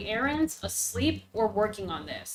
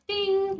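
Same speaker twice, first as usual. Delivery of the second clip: mock counter ding; 10 semitones higher, 5 dB louder than usual. Mock sin counter sound.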